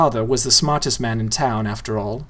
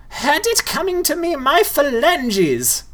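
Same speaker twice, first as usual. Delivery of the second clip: much higher, very slow ghoul squawk; indignant ghoul; slower than usual. A narrator's reading. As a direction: cry out in mock outrage. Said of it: "shouted the one called Roebuck".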